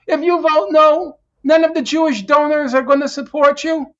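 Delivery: loud mock scolding from a party leader; mock Nancy Pelosi. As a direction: lecture her like a scolding party boss. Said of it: "what Nancy Pelosi is telling her".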